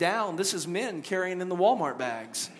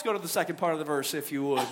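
ordinary delivery

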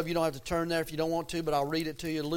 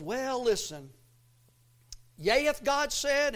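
a hint of a tempter, pitched up; lightly voiced devil; 6 semitones higher than usual. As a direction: lightly play the devil sowing doubt. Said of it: "the devil comes along and says".

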